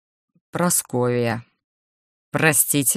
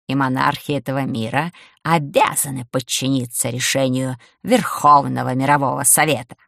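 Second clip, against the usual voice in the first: ordinary delivery, nothing marked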